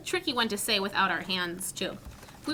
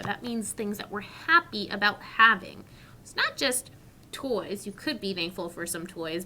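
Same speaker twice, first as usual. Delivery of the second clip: ordinary talk, nothing marked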